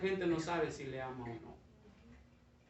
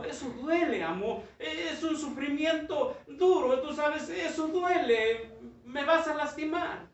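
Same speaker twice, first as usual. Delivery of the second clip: much higher, slow pleading lament; pleading bamboo; 9 semitones higher, 4 dB louder than usual. Acted. Bamboo pleading that the cutting will hurt, high slow lament. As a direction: beg the master to spare you.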